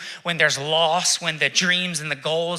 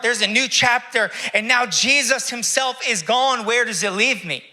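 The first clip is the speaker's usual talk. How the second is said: Mary's lament; 8 semitones higher, 4 dB louder than usual. Acted.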